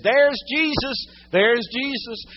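loud, high excited cry; excited child's scream. Retold or imitated. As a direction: imitate a little girl crying out, thrilled. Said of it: "she just screamed with excitement".

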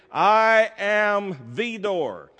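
"I am the door" very slow, "the" said as "thee"; emphatic THE. Retold or imitated. Preacher making sure they hear Jesus' words, slow stress on "the" as "thee". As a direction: hammer out "I am the door" word by word, slowly. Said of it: "here's what I want you to hear".

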